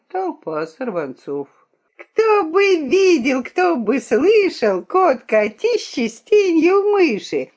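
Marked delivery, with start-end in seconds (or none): 1.9-7.5 high, drawn-out taunting chant; дразнилка. A narrator's reading. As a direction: tease in a loud, high street-urchin voice. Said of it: "Дразнили они мыши-брата". (дразнить высоким протяжным голосом)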